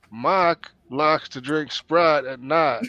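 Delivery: slow Texas drawl with "Mike" said as "Mahk"; Texas accent. Retold or imitated. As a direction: stretch it out like a Texan, "Sprite" as "Sprahd". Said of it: "if they're from Texas".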